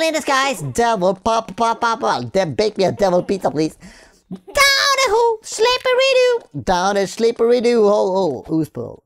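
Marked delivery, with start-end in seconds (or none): 4.3-6.6 high squeaky singsong; silly high falsetto rhyme. Pretend. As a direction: squeak it out like a cartoon.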